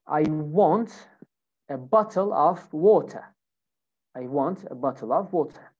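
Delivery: British accent, T pronounced in bottle and water; British pronunciation demo for learners. Shown as a demonstration. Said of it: "So here in British accent".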